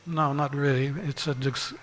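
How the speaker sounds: ordinary delivery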